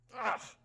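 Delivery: loud, high startled grunt of pain; pained cry. A narrator's reading. Villain struck by a sudden attack, loud pained cry. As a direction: cry out as you're engulfed. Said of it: "Kurogiri cried out as something engulfed him".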